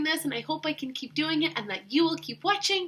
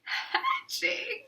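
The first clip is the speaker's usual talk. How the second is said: breathy whispered word; Hatching in a hushed whisper; 11 semitones higher, 6 dB quieter than usual. Shown as a demonstration. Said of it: "wrote that word hatching really big".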